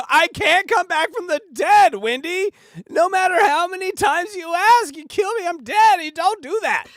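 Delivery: mock desperate squeal; high excited rant. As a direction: plead in a squeaky frantic voice. Comic rant addressing Wendy.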